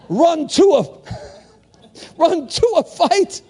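high, drawn-out rallying cry; rousing repeat. Real, not acted. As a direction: draw it out slowly, pitched high, with a laugh. Repeats it after few amens.

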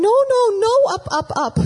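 put-on high, loud scared child cry of no; a frightened little girl protesting the takeoff; toddler panicking. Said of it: "she starts going".

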